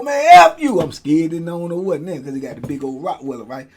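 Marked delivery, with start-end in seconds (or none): 0.0-0.8 higher, louder curse back; defiant curse. Retold or imitated. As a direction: spit it back at the guards. Cursing back at guards.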